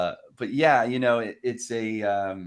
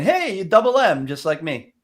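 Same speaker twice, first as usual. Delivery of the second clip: playful greeting; 8 semitones higher, 6 dB louder than usual. Retold or imitated. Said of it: "by saying".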